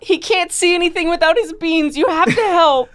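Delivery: pleading in a high voice. Acted. Voicing a pleading character.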